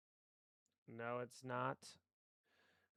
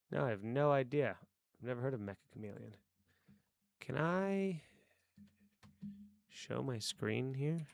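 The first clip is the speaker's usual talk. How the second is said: ordinary talk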